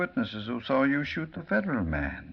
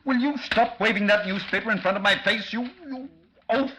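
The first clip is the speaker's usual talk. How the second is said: furious outburst; 10 semitones higher, 4 dB louder than usual. Acted. Bly shouts at waving newspaper.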